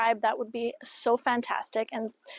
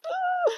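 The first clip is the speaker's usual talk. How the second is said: very high short yelp of panic; a quick flustered "Ah"; mock startled squeak. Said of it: "I'm like".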